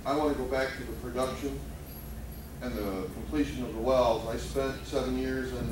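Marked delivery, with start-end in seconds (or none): none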